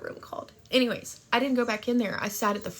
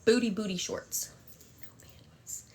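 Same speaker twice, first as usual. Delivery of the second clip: hushed whisper; 4 dB quieter than usual. Real, not acted. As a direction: whisper it like a secret. Gossipy aside, hushed.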